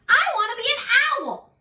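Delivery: high, small frog voice, pleading; a small frog begging to be an owl; childlike hopeful frog; eager little frog. Acted. Little frog's wish in story.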